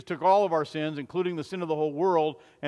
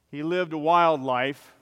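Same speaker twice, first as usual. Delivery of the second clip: ordinary talk, nothing marked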